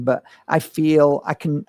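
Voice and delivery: ordinary delivery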